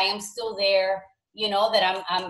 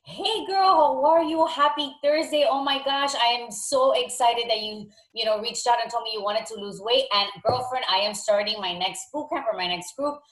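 peppy coach leaving an excited voicemail; super excited; 2 semitones higher than usual. Shown as a demonstration. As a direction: gush like you can't wait to see her. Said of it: "super excited".

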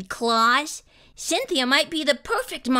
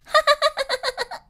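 high squealing giggle; child's high giggle. Acted. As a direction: laugh like a delighted toddler. Emma giggles.

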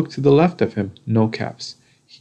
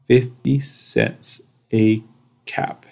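usual speaking voice

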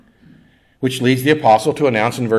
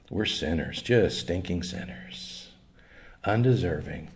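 hushed confession; 7 semitones lower, 11 dB quieter than usual. Real, not acted.

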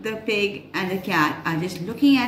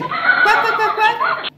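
high, laughing quack quack; duck quacking; 13 semitones higher, 6 dB louder than usual. A narrator's reading. Duck answering the hen with quacks. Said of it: "The duck said".

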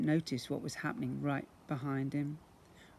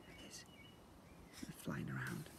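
whisper; 20 dB quieter, faster than usual. Acted.

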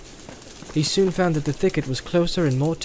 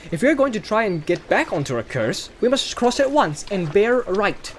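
put-on higher, lively young hobbit arguing back; Pippin, objecting; 9 semitones higher than usual. A narrator's reading. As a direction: push back on the plan, a bit cocky. Pippin's dialogue in read story.

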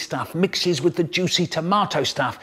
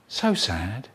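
softer, low, trailing sadness; sad and fading; 5 semitones lower, 5 dB quieter, slower than usual. Acted. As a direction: let the sadness sink. Describing a sad place.

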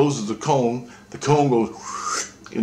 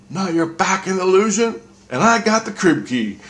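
raised Christ voice; 9 semitones higher, 3 dB louder than usual. Retold or imitated. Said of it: "and Christ goes".

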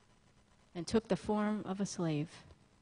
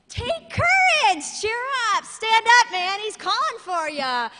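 much higher, louder, stretched crowd cheer; hammed-up eager crowd urging him up; 11 semitones higher, 10 dB louder than usual. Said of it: "And now they're saying".

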